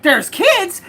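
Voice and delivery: shouting back at the neighbor, outraged; incredulous angry yell. Retold or imitated. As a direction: fling his "There's kids?" back in disbelief. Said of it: "And I'm like".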